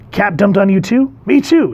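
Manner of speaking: high, loud eager me too; gleeful kid bonding; a touch of excited teen; mock excited. Teens bonding over Cap.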